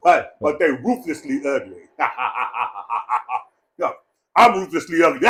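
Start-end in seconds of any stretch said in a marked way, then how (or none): 2.0-3.4 low, softer run of chuckles; staccato laugh. Real, not acted. Speaker amused at own ruthlessly ugly joke, laughing. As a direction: let a string of ha-has roll out.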